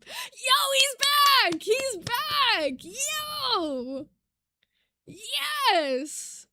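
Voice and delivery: high, loud thrilled cheering; squealing with joy; real excitement. Real, not acted. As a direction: cheer the otter's return. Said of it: "this is so exciting".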